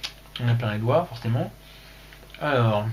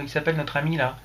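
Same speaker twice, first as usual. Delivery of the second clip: normal talking voice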